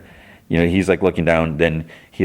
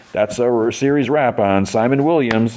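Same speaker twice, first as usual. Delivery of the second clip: higher-pitched mock set announcement; announcing; 6 semitones higher than usual. Crew member announces the series wrap, calling out. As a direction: call it out to the crew.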